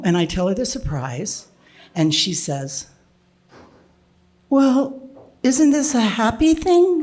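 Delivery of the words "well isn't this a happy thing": put-on high, childlike delight in a light voice; like a little girl. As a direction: sound like a little girl again. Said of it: "Like she's a little girl again".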